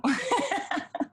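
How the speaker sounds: bright high burst of laughter; sudden high-pitched laugh. Real, not acted. Host laughs at reply.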